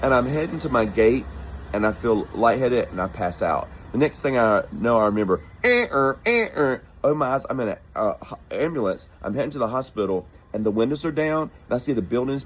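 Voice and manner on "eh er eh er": high two-note wailing siren sound; siren imitation. Waking up in the ambulance, imitating its high siren.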